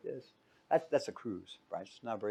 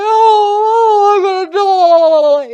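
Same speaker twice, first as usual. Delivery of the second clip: hammed-up high, loud panicked wail; freaking out; 21 dB louder, much slower than usual. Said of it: "You know, all freaking out".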